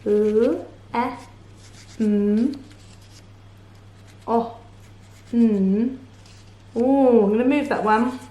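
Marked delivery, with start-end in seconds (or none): 0.0-6.0 "L, E, M, O, N" as phonics sounds, not letter names; separate letter sounds. Shown as a demonstration. Teacher adding sound buttons, each sound said alone. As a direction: hold l, m and n, short vowels, long gaps between. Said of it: "Sound buttons, here we go".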